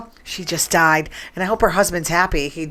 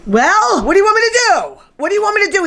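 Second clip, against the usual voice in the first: higher, louder, exasperated protest; exasperated outburst; 11 semitones higher, 3 dB louder, faster than usual. Real, not acted. Host snaps back at co-host John, exasperated and loud. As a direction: throw it back at him, fed up. Arguing with John about Trump.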